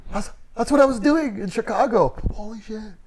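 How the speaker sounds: high, excited reenacted epiphany; amazed realisation. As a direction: burst out high, then drop to a hushed gasp. Retells his sudden realisation watching film.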